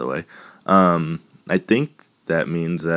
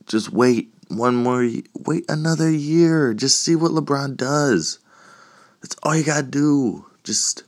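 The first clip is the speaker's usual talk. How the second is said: high-pitched urging, voice held up; exasperated pleading; 7 semitones higher than usual. Real, not acted. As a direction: plead with the player to be patient. Exasperated plea to absent player.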